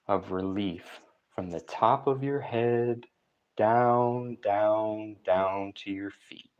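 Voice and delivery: unhurried, calming relaxation coach; guided meditation. Real, not acted.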